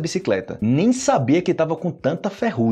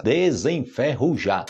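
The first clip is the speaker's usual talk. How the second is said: syllable by syllable; slower than usual. Shown as a demonstration. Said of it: "Alguns verbos pra gente".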